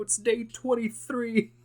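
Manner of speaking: high, slow cartoon crab voice; Mister Krabs impression. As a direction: drag out the day count like a cartoon crab. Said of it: "Mister Krabs like".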